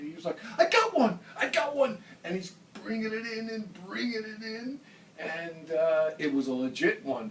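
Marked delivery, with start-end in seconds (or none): 0.6-2.0 high, loud, thrilled cry; excited. Retold or imitated. Jerry hooking a fish, shouting excitedly to John. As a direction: shout it like a kid landing a first fish. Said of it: "he got so excited".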